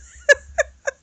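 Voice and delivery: loud, high incredulous laugh; high burst of laughter. Retold or imitated. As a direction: cackle at the absurdity. Laugh closing the retold quote.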